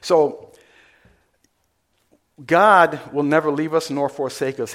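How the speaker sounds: normal talking voice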